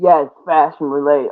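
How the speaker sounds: ordinary talk, nothing marked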